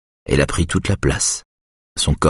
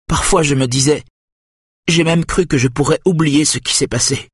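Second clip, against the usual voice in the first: higher-pitched hurt wife; voiced wife, hurt; 11 semitones higher than usual. A narrator's reading. Wife reproaching husband for broken trust, hurt. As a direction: lightly raise the voice for the betrayed wife.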